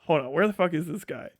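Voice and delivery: ordinary talk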